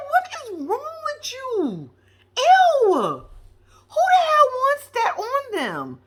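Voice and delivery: very high, drawn-out mock disgust; exaggerated high-pitched revulsion; grossed-out squeal. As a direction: squeal it slowly like you're revolted. Grossed-out reaction to the kiss.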